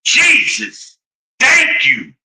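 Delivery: high, sarcastic thanks; exasperated relief. Retold or imitated. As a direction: throw up your hands in relief. Relief after finally getting answer.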